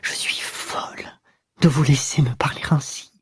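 hushed whisper behind hands; whispered through fingers. A narrator's reading. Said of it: "répondit entre ses doigts".

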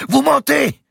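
very high, loud accusation; furious shout. A narrator's reading. Archbishop cries out at the grand master, furious shout. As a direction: hurl it out in rage. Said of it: "Il était blême et tremblant de colère".

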